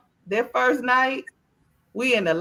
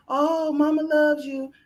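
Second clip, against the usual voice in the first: higher, slow, sugary mama voice; mock nice mama; 6 semitones higher, slower than usual. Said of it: "They got the nice mama".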